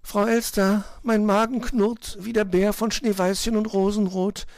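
high piping bird complaint; high, insistent chirpy voice; whining little bird. A narrator's reading. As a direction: pipe it plaintively as a tiny bird. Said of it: "behauptete Zwitschi".

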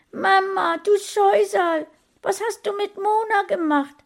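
put-on high, loud, desperate boy's plea. A narrator's reading. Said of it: "fragte Sascha verzweifelt". (hoch und verzweifelt fragen)

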